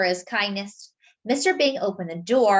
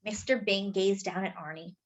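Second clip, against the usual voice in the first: ordinary delivery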